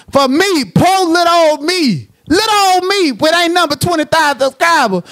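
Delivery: mock self-pity; mock poor little me.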